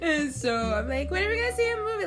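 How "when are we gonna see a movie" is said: excited. Retold or imitated. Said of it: "I was excited".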